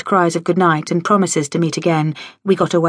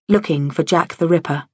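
plain reading voice

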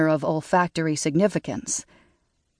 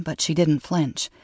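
ordinary delivery

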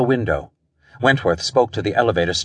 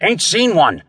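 high, agitated guard's reply; panted out; 8 semitones higher than usual. A narrator's reading. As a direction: puff it out in alarm. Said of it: "he panted out".

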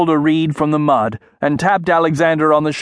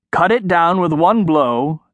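usual speaking voice

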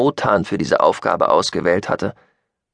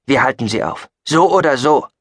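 firm resolve, rising louder and higher; decisive; 5 semitones higher, 5 dB louder than usual. Acted. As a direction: settle it, no argument. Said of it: "entschied Chayton". (entschlossen, mit Nachdruck)

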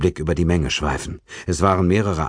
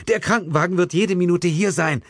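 a higher, urgent policewoman voice; lightly voiced policewoman; 12 semitones higher than usual. A narrator's reading. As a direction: reassure the victim from above, urgently. Policewoman reassures victim.

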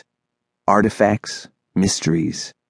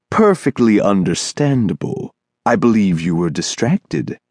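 a lightly voiced, lilting strange man; smooth and musical, a bit too polite; singsong thin man. Thin man to the boy who bumped him, lilting.

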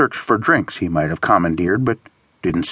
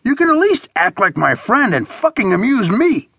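exasperated shout; 14 semitones higher than usual. A narrator's reading. Bored friend demanding to be amused, shouting. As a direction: cry out in high exasperation without much added volume. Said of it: "he shouted".

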